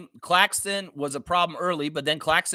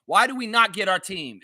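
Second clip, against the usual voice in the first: ordinary talk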